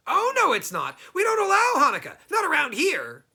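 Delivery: high, loud goblin forbidding Hanukkah. Acted. Goblin telling Herschel Hanukkah is forbidden, high and loud. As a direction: squeal it out like an angry little goblin.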